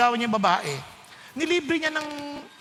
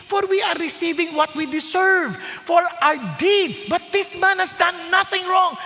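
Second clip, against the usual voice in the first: higher, raised rebuke; rebuking thief; 6 semitones higher than usual. Acted. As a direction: scold the other thief.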